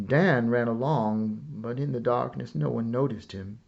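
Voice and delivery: ordinary delivery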